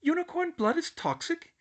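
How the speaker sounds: put-on very high, disbelieving girl; shocked question. A narrator's reading. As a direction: recoil in shock.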